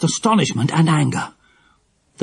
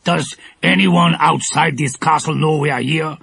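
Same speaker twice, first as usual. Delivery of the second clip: suspicious villain. A narrator's reading. Shaggy man questioning the girls, suspicious. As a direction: press them suspiciously. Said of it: "asked the shaggy man".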